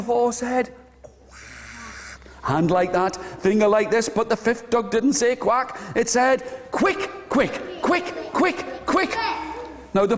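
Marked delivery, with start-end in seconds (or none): 1.1-2.2 voiceless, whispered quack; barely audible whispered quack; tiny duck quack. Acted. Fourth duck's barely audible quack. 6.7-9.2 high, squeaky duckling chirps; high-pitched duckling cries; excited little duck. Acted. Fifth duck says quick instead.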